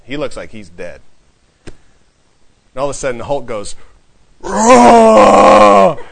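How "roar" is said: huge, long, loud roar; terrible bellowing roar, pitched up and drawn out; Hulk's roar. Acted. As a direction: let out a big, crazy monster roar. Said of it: "he roars this big, crazy, terrible roar".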